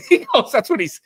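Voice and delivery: high burst of laughter breaking in; laughing. Real, not acted. Laughing at his own impression of a colleague.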